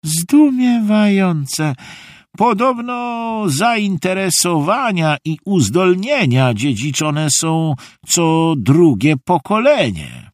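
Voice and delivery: high, drawn-out theatrical wonder; marvelling grandpa. A narrator's reading. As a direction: muse aloud as an amazed old drama teacher. (wysoki, przeciągły zachwyt dziadka)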